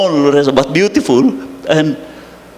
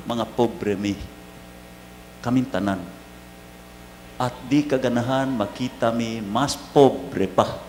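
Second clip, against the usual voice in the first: tender words to mother; 4 semitones lower, 13 dB quieter than usual. Real, not acted. Priest speaking to dead mother.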